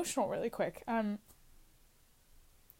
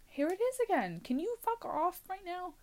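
much higher, fed-up telling it to go away; exasperated, pitched way up; annoyed outburst; 12 semitones higher than usual. Real, not acted. Vlogger fed up with an interruption, snaps high and irritated.

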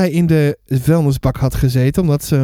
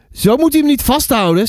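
raised, higher voice without much volume; mild shout; 6 semitones higher than usual. A narrator's reading. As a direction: protest as an upset child, pitched up. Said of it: "schreeuwde ik". (licht geroepen protest, hogere stem)

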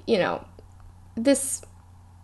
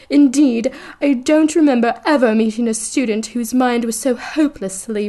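higher, louder affected seer voice; haughty Trelawney; 4 semitones higher, 4 dB louder than usual. A narrator's reading. Reading Trelawney scolding Hermione, in a lofty affected voice. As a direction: look down your nose at her. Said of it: "Professor Trelawney".

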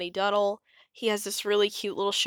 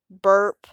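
loud burp; 9 dB louder than usual. Acted. Dinosaur burps after eating.

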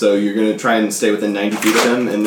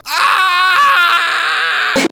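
very high scared shriek; terrified shriek. Acted. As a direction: shriek in panic. Goblin cries out fleeing.

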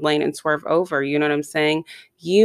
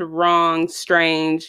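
ordinary delivery

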